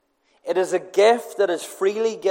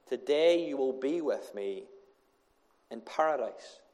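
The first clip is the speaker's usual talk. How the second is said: soft and tender; 4 semitones lower, 9 dB quieter than usual. Acted. Jesus' promise quoted softly.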